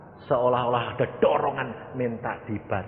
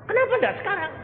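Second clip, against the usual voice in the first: much higher urgent question; pressing question; 3 dB louder, faster than usual. Retold or imitated. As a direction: push for now, not tomorrow. Pastor pressing relative. (pertanyaan mendesak, nada tinggi)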